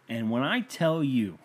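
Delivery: ordinary delivery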